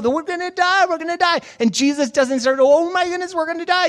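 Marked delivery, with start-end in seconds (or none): 0.0-1.4 high-pitched frantic retelling; mock panic. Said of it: "they're freaking out". 2.6-3.9 high-pitched frantic retelling; mock panic. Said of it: "they're freaking out".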